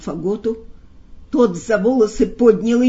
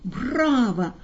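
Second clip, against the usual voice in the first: plain reading voice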